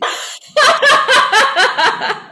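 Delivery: loud, high burst of laughter; big laugh. Real, not acted. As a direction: let a real laugh burst out. Laughing at the memory.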